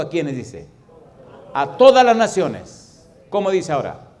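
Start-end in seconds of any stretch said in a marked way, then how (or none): 1.5-3.1 "A todas las naciones" drawn out slow, much higher and louder; preaching at full force. Acted. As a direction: thunder each word to the back pew. Preacher proclaims the Great Commission.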